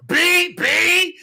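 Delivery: loud, high bleeped-out swearing; retold cussing. Answering his sister's calls, retold loud cussing. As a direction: fire back high, loud mock curses. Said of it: "cuss it out".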